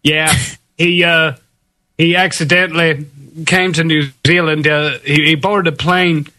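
put-on American accent, laid-back. Shown as a demonstration. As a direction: keep up the American impression. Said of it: "an American accent".

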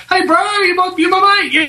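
high, hammed-up mate-to-mate plea; mock matey bro voice. Host voicing an imagined friendly stranger, high mock mate voice. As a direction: play the friendly bro pleading for peace. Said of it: "you know, just like".

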